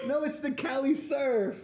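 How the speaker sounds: high laughing surfer quip. Real, not acted. Joking about two toes.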